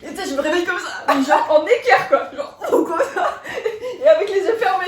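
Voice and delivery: laughing through a fast, excited telling. Real, not acted. Laughing as she tells her friend about waking up. Acting out waking up bolt upright.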